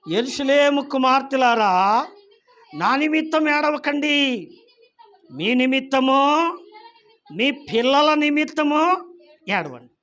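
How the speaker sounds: Jesus addressing the women. Acted. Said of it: "యేసు వారి వైపు తిరిగి".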